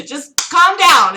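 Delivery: loud, slow, high calm down. Real, not acted. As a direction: talk a friend down, loudly and slowly. Calming excited friend.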